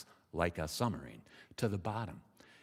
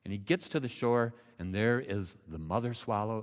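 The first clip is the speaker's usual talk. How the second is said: plain reading voice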